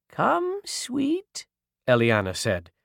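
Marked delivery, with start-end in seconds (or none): 0.1-1.5 high, soft, coaxing voice; soothing old witch. A narrator's reading. Eliana reaching for Ruby, soothing old witch. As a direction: coax a child gently in a high voice.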